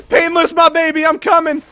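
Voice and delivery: high, very loud holler; angry long-distance yell. Pretend. Player charging in to scare off enemies, angry yell. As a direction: yell it across a great distance. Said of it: "I yelled at them real angrily from a very long distance".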